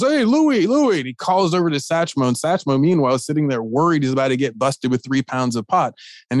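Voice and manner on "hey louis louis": high hail across a crowd; calling out. Retold or imitated. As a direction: call his name over the bustle, pitched up. Nixon calls across the airport.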